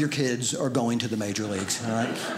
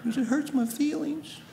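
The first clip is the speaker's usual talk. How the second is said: put-on high, slow whiny child; high slow pouty kid voice; mock hurt kid; 11 semitones higher, 3 dB quieter, slower than usual. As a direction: mimic a pouting kid. Mocking son's hurt tone.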